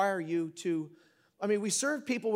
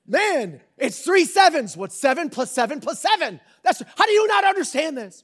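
loud, higher exasperated ranting; mock exasperation; 7 semitones higher, 9 dB louder than usual. Dad scolding a child over homework, loud exasperation. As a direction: rant like a dad losing patience.

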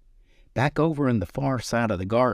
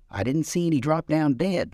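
mild mock shout; 8 semitones higher than usual. A narrator's reading. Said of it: "the boy shouted this time".

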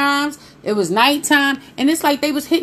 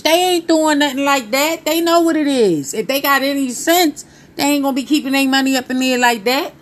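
ordinary talk, nothing marked

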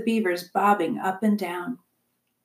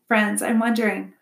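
plain reading voice

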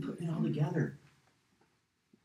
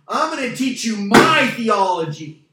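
loud, pompous put-on voice for "I'm gonna teach you my theology"; mock arrogant teacher; 7 semitones higher, 14 dB louder than usual. Pastor mocking himself as an egomaniac, loud and pompous. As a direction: puff up like a self-important preacher. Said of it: "if I got up here and".

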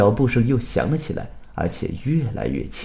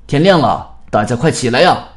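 raised voice, loud and higher; loud call; 3 semitones higher, 8 dB louder than usual. A narrator's reading. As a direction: shout out a wake-up call to everyone. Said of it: "大声喊道". (大声喊叫)

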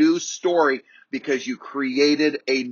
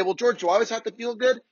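higher, quick voice of a questioning trainee; doubting listener's question; 7 semitones higher, faster than usual. Retold or imitated. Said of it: "You say".

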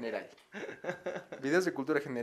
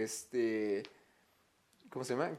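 ordinary talk

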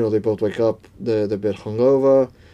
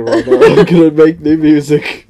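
laughing through the words, louder; 3 semitones higher, 8 dB louder than usual. Real, not acted. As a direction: giggle while announcing it. Giggling about inventing music.

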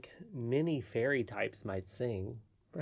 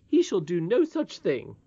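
firm, raised-pitch refusal; indignant; 6 semitones higher, 6 dB louder than usual. Acted. Nurse forbidding first-name familiarity, indignant. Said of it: "said the nurse indignantly".

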